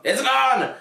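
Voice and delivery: high, loud triumphant gone; gleeful triumphant cry. Acted. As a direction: cheer it with glee. Gone then gone! contrast.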